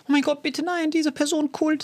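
high, breathless fan swoon. Retold or imitated. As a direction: gush like a starstruck fan. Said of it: "Ich bin nie so ein Fan, der so".

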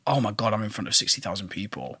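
low, soft, stunned aside; hushed awe at a huge crowd; awed hush. Retold or imitated. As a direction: breathe it out in disbelief. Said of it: "I was like".

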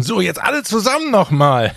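high, projected call to a crowd; playful calling-out; mock rallying call. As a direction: rouse the whole queue like a chorus leader. Imagined rallying of the queue.

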